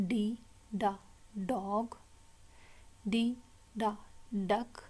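"D", pause, short "da", pause, "dog"; letter, sound, word. Shown as a demonstration. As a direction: drill "D, da, duck" in spaced beats. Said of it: "the next sound is". ("D, da, dog" रुक-रुककर)

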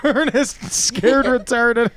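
laughing out the title, slowly; slow, high, laughing repeat. Real, not acted. Repeating the joke while laughing.

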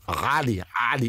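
"Ali" with a throaty Arabic first vowel; Arabic pronunciation. Shown as a demonstration. Demonstrating the actual name, guttural Arabic "Ali". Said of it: "An is a guttural A".

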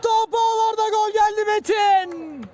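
high, loud goal scream; screaming football commentator. Real, not acted. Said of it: "first take of goal call".

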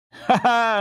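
loud, high good-natured ha ha; hearty laugh. A narrator's reading. Good-natured Jacob laughing at himself, loud and hearty. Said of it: "the good-natured Jacob laughed more than any".

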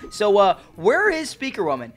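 normal talking voice

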